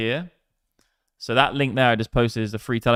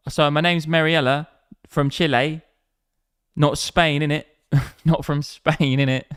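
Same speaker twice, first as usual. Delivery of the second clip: louder, higher Cockney parody; mock Cockney; 4 semitones higher, 5 dB louder than usual. Streamer parodying the caller's voice note, mock Cockney accent. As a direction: redo the introduction as a cheeky Cockney. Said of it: "You sound like a proper Cockney".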